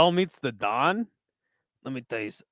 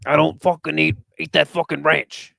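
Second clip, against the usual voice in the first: Joey Diaz impression; 3 semitones lower, 3 dB louder, faster than usual. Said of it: "that's like Joey Diaz".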